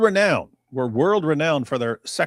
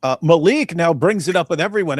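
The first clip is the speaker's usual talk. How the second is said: ordinary talk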